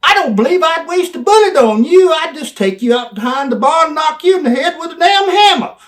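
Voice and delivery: very high, very loud yelling insult; full-throated tirade. Retold or imitated. Manager hitting Brian with the closing line, very loud yell. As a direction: scream the closing insult at him. Climax of belittling promo.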